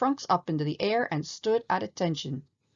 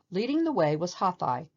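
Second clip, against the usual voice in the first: usual speaking voice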